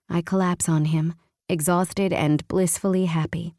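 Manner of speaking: plain reading voice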